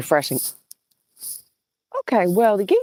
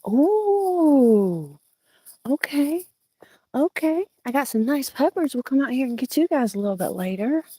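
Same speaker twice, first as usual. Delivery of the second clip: cooing; 8 semitones higher than usual. Real, not acted. Cooing over garden finds.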